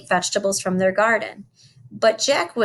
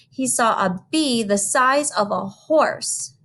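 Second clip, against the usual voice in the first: ordinary delivery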